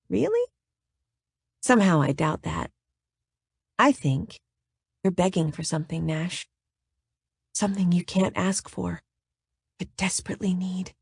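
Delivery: soft, breathy seduction; breathy, sexy. Acted. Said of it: "breathless speech".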